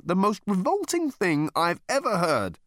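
lightly voiced revolted man; man appalled at the food; appalled Arthur. A narrator's reading. Arthur speaks.

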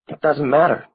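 put-on high, small girl's voice; a little girl shrugging it off; girlish little voice. A narrator's reading. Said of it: "in a girlish little voice".

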